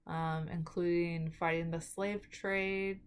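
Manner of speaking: ordinary talk, nothing marked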